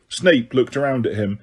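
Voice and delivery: usual speaking voice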